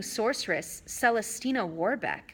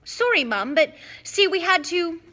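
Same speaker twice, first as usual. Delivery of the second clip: pleading twin; 8 semitones higher, 6 dB louder than usual. A narrator's reading. Twins pleading with mother.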